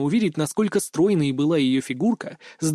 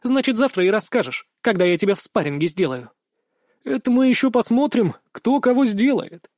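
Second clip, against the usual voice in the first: pitched-up teasing challenge; playful bickering; 6 semitones higher than usual. A narrator's reading. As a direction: trade joking threats, voice raised in pitch. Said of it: "шутливо переругиваясь". (шутливо переругиваясь, с задором)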